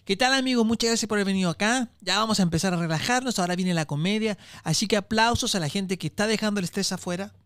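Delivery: ordinary talk, nothing marked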